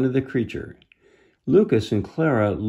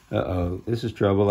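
ordinary delivery, nothing marked

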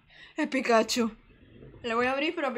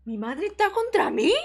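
1 shocked disbelief; 6 semitones higher, 6 dB louder than usual. Real, not acted. Shocked at game twist.

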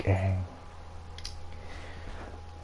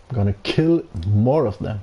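normal talking voice

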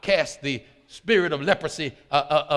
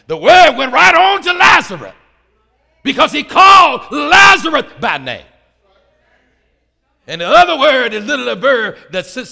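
high, shouted pulpit delivery; exultant preacher at full volume; full-force preaching; 9 semitones higher, 15 dB louder than usual. Acted. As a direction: shout the point home to the congregation.

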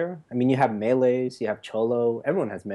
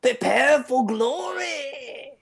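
very high, drawn-out hammed-up accent; Hearthstone innkeeper; 13 semitones higher, much slower than usual. Player trying a Scottish accent for friends, hammed-up innkeeper voice. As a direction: stretch it into a big game-character battle cry. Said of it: "I was trying to do the innkeeper".

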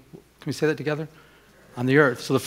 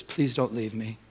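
softer, lower retold plea; tearful plea; 4 semitones lower, 7 dB quieter than usual. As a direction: quietly imitate her pleading. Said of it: "with tears in her eyes".

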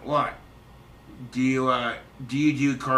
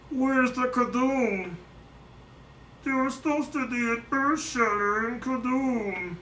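high, measured cartoon alien voice; pinched high Looney Tunes alien; Marvin the Martian; 9 semitones higher than usual. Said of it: "How about Marvin the Martian?".

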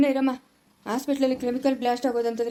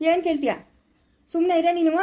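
loud, higher furious order; angry shout; 4 semitones higher, 3 dB louder than usual. Acted. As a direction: cut her off hard. Father yells at daughter.

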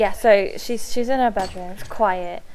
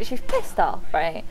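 ordinary talk

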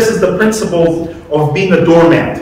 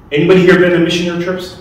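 ordinary talk

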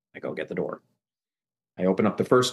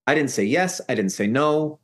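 usual speaking voice

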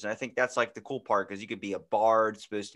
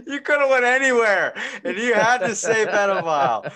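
laughing hard; 11 semitones higher, 10 dB louder than usual. Real, not acted.

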